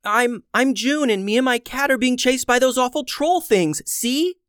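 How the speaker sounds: put-on high, breathless, stammering girl; frightened. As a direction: blurt it out scared and fast.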